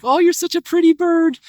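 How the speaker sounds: very high pet-praising coo; farmer cooing to bird. Retold or imitated. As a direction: imitate an owner gushing over a bird. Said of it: "and he's like".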